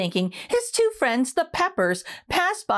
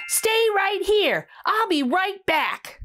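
put-on higher and slow, excited child; a kid with a bright idea; eager kid Rudy; 8 semitones higher, slower than usual. Rudy tells the broccoli, high and eager.

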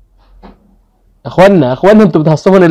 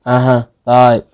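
ordinary talk

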